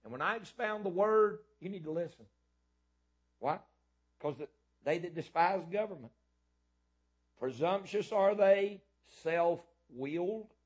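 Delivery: loud, high, hammering sermon voice; full-force preaching. Acted. Preacher shouting the word to his church, loud and high. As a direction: thunder the word at the congregation.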